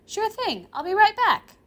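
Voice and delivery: high, breezy agreement; Rimuru's girl voice. Acted. Girl agreeing to her grandfather's errand, high and breezy. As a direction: pitch it high and chirp.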